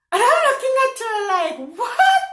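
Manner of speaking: very high, loud rising shriek; shocked disbelief. Retold or imitated. Reacting to being asked about Africa, shocked disbelief. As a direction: rush in, then yelp the question in mock shock. Shocked at customer's question retold.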